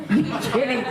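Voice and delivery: high, laughing American-style gasp of disbelief; surprised disbelief. Retold or imitated. Director hearing a star agreed, laughing in disbelief. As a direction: squeal it out in amazed disbelief. Team hears Gambon said yes.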